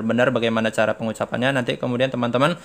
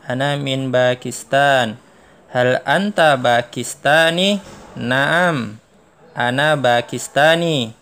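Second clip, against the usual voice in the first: slow, clearly spaced Arabic dialogue read-aloud; slow model dialogue reading; 3 semitones higher, 4 dB louder, much slower than usual. Shown as a demonstration. Teacher reading a model dialogue to students, slow and clear. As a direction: read each line slowly for the class to repeat. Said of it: "kita ulang ya".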